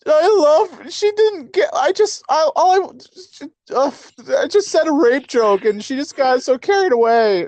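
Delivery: very high-pitched, halting mock blubbering; mock distraught. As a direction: stammer through a hammed-up meltdown. Acted breakup meltdown.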